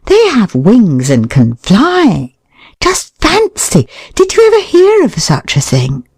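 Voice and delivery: hammed-up wide-eyed wonder; mock astonishment. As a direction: gasp at it like a miracle.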